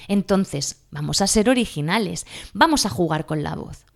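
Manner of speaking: ordinary talk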